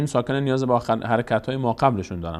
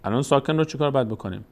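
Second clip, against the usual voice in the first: usual speaking voice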